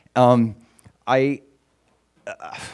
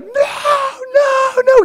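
high crying no; imitating the screaming son in the cold river; terrified child; 4 dB louder than usual. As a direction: wail it like a scared little boy. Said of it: "And he's like".

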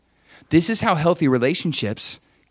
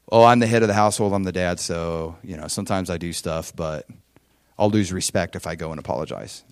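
mock excuse-making; 5 semitones lower than usual. Dad excusing himself from apologizing to kids, mock reluctant.

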